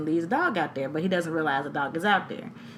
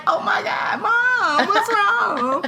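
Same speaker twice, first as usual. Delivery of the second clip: put-on high, loud frightened child; worried child; 13 semitones higher, 4 dB louder, much slower than usual. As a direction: play the scared son calling Mom. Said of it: "He was like".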